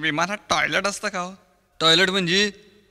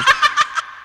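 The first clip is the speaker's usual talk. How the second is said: squeaky high giggle; 4 dB louder than usual. Acted. Comic high laugh.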